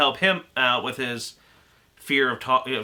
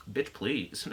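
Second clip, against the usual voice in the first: sassy; 2 semitones lower, 9 dB quieter than usual. Retold or imitated. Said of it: "Even he was like".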